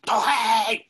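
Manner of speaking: yelling a name. Retold or imitated. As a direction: shout the name to find him. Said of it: "He goes yelling".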